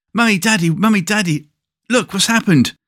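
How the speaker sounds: put-on excited child. Kid runs home excited.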